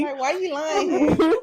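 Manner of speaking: put-on high, loud childish accusation; a kid calling mom out; whiny kid voice.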